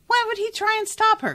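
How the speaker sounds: high, loud indignant question. Real, not acted. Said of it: "I'm so mad".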